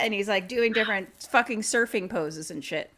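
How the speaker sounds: ordinary delivery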